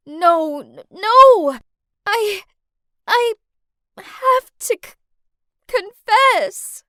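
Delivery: loud, high tearful stammer; sobbing out a confession; stuttering in tears. Acted. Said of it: "I stuttered in tears".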